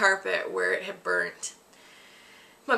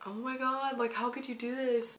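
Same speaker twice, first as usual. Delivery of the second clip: a hint of a higher, softer mom voice; imitated dismayed mom; 4 semitones higher, 4 dB quieter than usual. Mom hearing the candle excuse, lightly voiced dismay. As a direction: mimic the mom, disbelieving.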